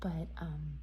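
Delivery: hushed early-morning whisper. Real, not acted. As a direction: whisper so nobody wakes. Up since three, quiet.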